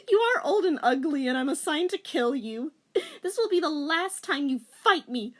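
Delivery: high, loud defiant taunt; defiant heroine. A narrator's reading. Rachel threatening the old witch, loud and high.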